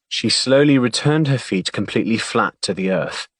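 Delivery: usual speaking voice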